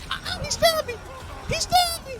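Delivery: high, loud outraged yell; shocked yelling. Real, not acted. Stabbed by enemy, yelling.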